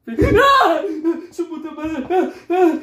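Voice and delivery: very high shriek and curse; hysterical scream. Acted. Breakdown ends in a cursing scream.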